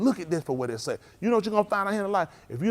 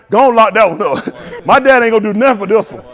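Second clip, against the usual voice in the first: laughing taunt; 2 semitones higher, 11 dB louder than usual. Retold or imitated. Mock taunt while laughing.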